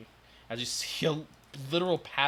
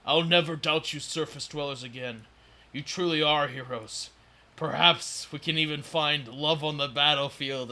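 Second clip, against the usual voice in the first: Duergar voice; 3 semitones higher, 8 dB louder than usual. Acted. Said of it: "this Duergar will say".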